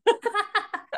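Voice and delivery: high burst of real laughter; amused giggle. Real, not acted. Laughing at the cake ad.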